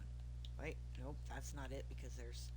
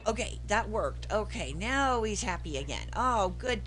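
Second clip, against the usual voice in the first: loud, slow relieved delight; happy and relieved, loud; loud relieved cheer; 3 semitones higher, 16 dB louder, slower than usual. Real, not acted. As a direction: celebrate the win loudly. Relief after dog happy.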